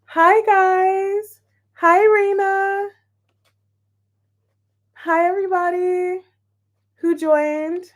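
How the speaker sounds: high, loud bubbly hello; excited greeting. Real, not acted. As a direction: greet them with a big lift.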